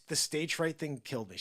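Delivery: ordinary delivery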